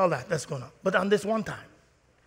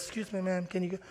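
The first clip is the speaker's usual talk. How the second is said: softer, calm official; polite referee; 10 dB quieter, faster than usual. Retold or imitated. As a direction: politely ask her to leave. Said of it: "the referee says".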